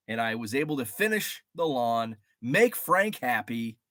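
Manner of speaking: ordinary talk, nothing marked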